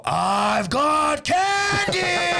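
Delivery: very high, long drawn-out holler; mock creepy shout. Retelling yelling the candy line at girls, mock creepy shout. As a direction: stretch every word into a high hammed-up yell.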